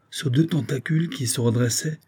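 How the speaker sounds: ordinary delivery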